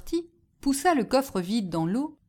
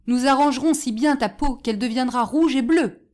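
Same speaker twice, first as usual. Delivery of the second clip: loud, raised threat; heated, louder warning; threatening; 4 semitones higher, 6 dB louder than usual. A narrator's reading. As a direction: threaten him as an angry mob. Angry crowd threatens while beating him.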